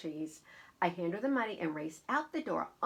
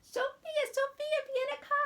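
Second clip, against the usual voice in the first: high-pitched Italian grandmother calling; very high, sing-out summons; Nana calling; 4 dB louder than usual. Acted. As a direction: call the child over in a high Nana voice. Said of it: "Nana calls me again".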